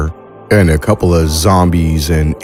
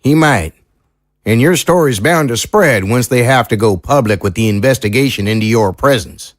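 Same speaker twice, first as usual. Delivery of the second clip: country lawman weighing the odds; folksy small-town sheriff, doubtful; 6 semitones higher, faster than usual. Acted. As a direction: play a sheriff giving an honest, wary opinion.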